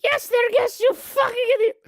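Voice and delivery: very high, loud scolding granny; shrieked high-pitched telling-off; exasperated grandma. Acted. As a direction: snap at a dim grandson as a bossy old woman. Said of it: "And she goes".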